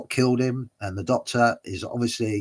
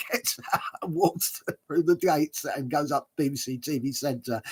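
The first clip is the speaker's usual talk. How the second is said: higher, laugh-filled telling; 5 semitones higher than usual. Real, not acted. Storyteller finishing his anecdote, still laughing. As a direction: carry on through the giggles.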